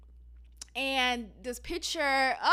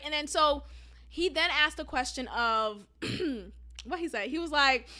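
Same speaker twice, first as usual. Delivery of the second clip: ordinary talk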